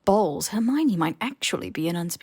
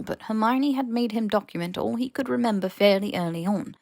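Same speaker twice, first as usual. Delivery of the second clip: usual speaking voice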